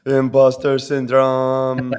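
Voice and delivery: drawn-out nervous announcement. Pretend. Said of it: "that's my nervous voice right there".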